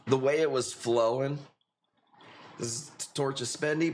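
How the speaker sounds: normal talking voice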